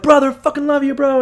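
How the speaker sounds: gushing Esau. Retold or imitated. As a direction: squeal the love at him like a hyped bro. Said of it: "And Esau's like".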